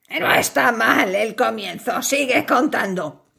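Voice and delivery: low, menacing spider voice; a big hungry spider toying with its prey. Acted. Spider answers fly.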